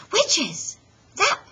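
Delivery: high, loud sudden idea; excited cry. Acted. Froggy in bed suddenly hits on his costume, high and loud. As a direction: burst out with the discovery. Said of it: "cried Froggy".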